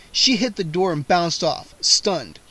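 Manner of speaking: ordinary delivery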